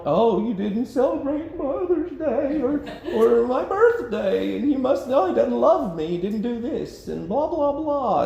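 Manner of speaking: high, whiny complaining voice; hammed-up aggrieved partner with laughter; mock whiny spouse.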